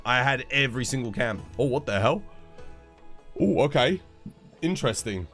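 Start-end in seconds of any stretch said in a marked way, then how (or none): none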